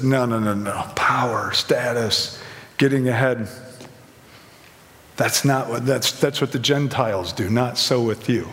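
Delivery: a steady, low refusal, calm but firm; lightly voiced Jesus. Jesus voiced rebuking James and John.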